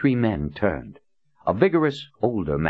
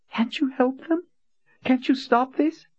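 high, desperate plea; lightly voiced frightened girl, pitched high; pleading young woman. A narrator's reading. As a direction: beg him to save the city.

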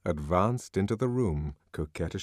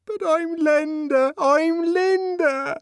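high, loud, slow sobbing plea; cling to him and cry out; desperate cry; 6 dB louder, slower than usual. Acted. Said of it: "Desperately she clung".

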